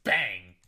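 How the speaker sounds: a punchy spoken bang; short loud mock bang; sound effect bang. Shown as a demonstration. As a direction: hit "Bang" like a gunshot. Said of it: "Don't I make good sound effects?".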